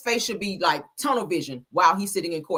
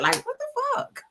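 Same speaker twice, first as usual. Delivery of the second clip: high incredulous squeal; a squeaky what the fuck; 13 semitones higher, 3 dB louder, faster than usual. Real, not acted. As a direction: shoot the voice up in disbelief. Outraged reaction, pitch way up.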